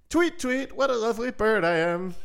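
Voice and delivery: high chirpy bird voice. Acted. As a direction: tweet it out like a proud little bird. Bird sings its song.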